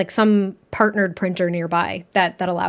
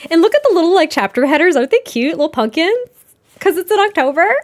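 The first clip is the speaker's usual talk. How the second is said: higher and louder, gushing over cute headers; excited gush; gushing delight; 6 semitones higher, 6 dB louder than usual. Real, not acted. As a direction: squeal over the little pumpkins.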